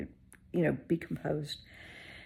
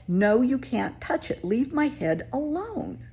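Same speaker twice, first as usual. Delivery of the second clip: slow, firm, higher no; firm refusal; 8 semitones higher, 3 dB louder, slower than usual. Retold or imitated. Telling HR woman to leave her head alone, slow and firm. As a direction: shut her down slowly.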